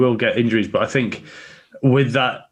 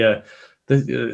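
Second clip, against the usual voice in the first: usual speaking voice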